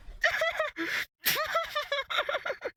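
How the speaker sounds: long high helpless giggle falling away; high laughing fit, can't stop. Acted. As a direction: laugh until it trails off.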